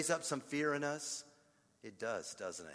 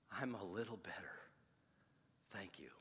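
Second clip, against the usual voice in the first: mild imitated near-whisper, much softer; hushed reply; 8 semitones lower, 14 dB quieter than usual. Comforted caller thanks the pastor after prayer, in a hush. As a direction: breathe it out, calmed and small.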